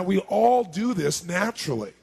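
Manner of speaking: usual speaking voice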